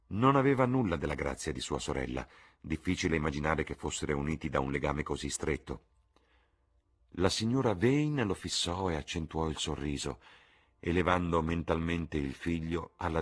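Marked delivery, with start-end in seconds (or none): none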